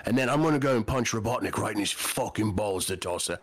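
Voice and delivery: put-on Cockney British accent; Cockney Knuckles. Fan voicing Knuckles planning the fight, mock Cockney. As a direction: play Knuckles as a Cockney bruiser. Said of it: "He needs that Cockney".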